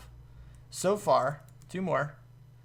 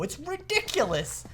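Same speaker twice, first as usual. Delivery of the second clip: normal talking voice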